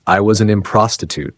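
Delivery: plain reading voice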